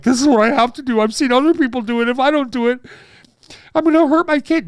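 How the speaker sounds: high, loud mock panic; mocking anxious parents. Comic mocks first-time parents, high loud mock panic. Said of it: "they're going like".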